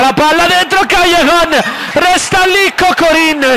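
high, rushed play-by-play build; breathless italian goal commentary. Acted. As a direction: call the attack rising in pitch. Live play-by-play rising.

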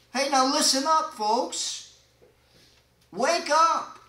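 loud, high call to wake up. Acted. [name] as preacher calling congregation.

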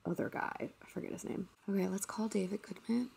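hushed whisper off the call; whispering an aside away from the caller. Real, not acted. Whispering to viewers during a scam call.

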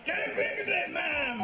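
high, urgent shout at the screen; yelling at the telly. Real, not acted. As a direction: bellow at the player to clear it. Yelling at football on TV.

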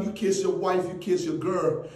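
ordinary delivery

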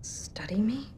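breathy stunned repeat, barely voiced; whispered disbelief. [name] whispering to Nathan in disbelief. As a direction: whisper it back in shock.

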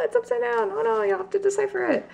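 high-pitched excited fluster; excited dismay. Real, not acted. Opener finding the card upside down, excited dismay. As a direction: blurt it out high, still giddy.

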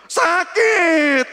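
high, loud cry of pain; pained shout. Retold or imitated. Wife in labour answering her husband, pained shout. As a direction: shout it out as if it really hurts.

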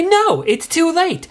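high, loud, slow outburst; outraged no. Retold or imitated. Woman yelling at the McDonald's clerk, outraged. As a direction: let the indignation explode. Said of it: "And I say".

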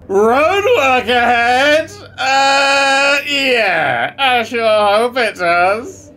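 slow shouted meme quote, high and loud. Pretend. Gamer yelling the roadwork meme at his screen. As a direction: drag out the meme line at full shout.

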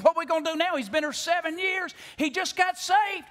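hammed-up worried congregation; mock panic. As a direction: play the alarmed church members in mock panic. Church folks panicking over pastor.

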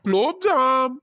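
high, drawn-out call. Acted. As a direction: call after someone ignoring you. கண்டுக்கவே மாட்டேங்கிறா.

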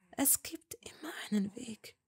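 soft whisper. A narrator's reading. Hermine whispering to Malfoy. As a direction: whisper it with hope. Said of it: "flüsterte sie".

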